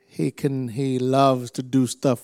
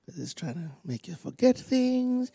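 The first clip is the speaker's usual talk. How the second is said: normal talking voice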